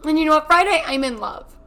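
loud, high, defiant and triumphant; a sudden proud loud declaration; loud defiant burst. Acted. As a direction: fling it out like a comeback.

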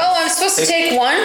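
high, quick laughing question; laughing surprise. Real, not acted. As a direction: laugh it out, caught off guard.

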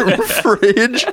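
laughing announcer. Acted. Mock show title, laughing.

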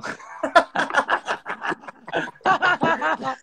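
high, long burst of laughter. Real, not acted. Friend cracking up at the peluche story, real laughter. Reacting to the punchline.

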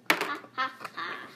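loud mock evil laugh; villain laugh. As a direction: cackle it out, cartoon baddie.

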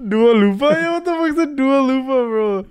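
high mocking bro voice; frat bro. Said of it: "that's the Walmart version".

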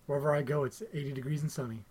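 low, soft hypnotic reassurance; mock self-affirmation. Co-host reciting his bedtime tape, soft and low. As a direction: quietly play your own bedtime tape. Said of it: "That's what the voice says that I tape".

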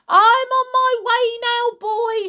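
high, loud, exultant call. A narrator's reading. Grandma calls down to George, exultant shout. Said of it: "she called down to George".

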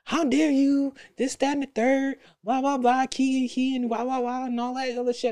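put-on a light parody of a girlfriend going off; mock outraged girlfriend. Girlfriend going off over a Facebook post, mock outraged. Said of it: "she went off".

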